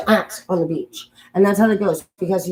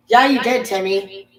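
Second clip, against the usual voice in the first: insistent correction; 6 dB louder, slower than usual. Acted. Telling pupil Timmy he did fail, insistent raised voice. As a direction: push it harder, insisting.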